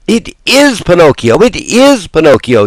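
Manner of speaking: high, loud joyful yell. Acted. All the marionettes pouring onstage, joyful yell. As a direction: cheer it like a crowd. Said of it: "yelled all the marionettes".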